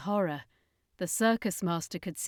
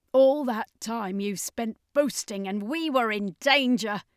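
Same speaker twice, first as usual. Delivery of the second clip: louder bitter reproach; angry accusation; 3 semitones higher, 6 dB louder than usual. Acted. Quilax blaming the circus master, angry. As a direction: throw the blame at them.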